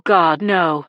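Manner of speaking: usual speaking voice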